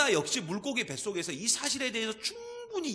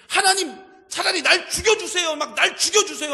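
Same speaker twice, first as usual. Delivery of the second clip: higher, much louder, shouted plea to die; furious demand; 8 semitones higher, 10 dB louder than usual. Retold or imitated. Jonah shouting at God, an acted furious plea. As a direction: rage at God like a sulking prophet. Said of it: "막 성질을 내고 막 하나님 앞에 화를 내고".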